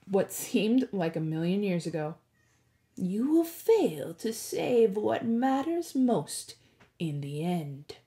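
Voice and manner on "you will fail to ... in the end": the oracle's slow, ghostly warning; ominous prophecy voice. A narrator's reading. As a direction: intone it like a doom-laden oracle.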